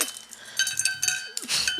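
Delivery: very high, falling cackle; high shrieking laugh. Real, not acted. Streamer laughing after knocking her phone over.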